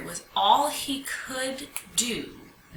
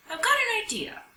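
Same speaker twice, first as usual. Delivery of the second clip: a much higher, bright burst of inspiration; light up with a new idea; sudden idea; 12 semitones higher than usual. A narrator's reading. Sam thinks of a plan.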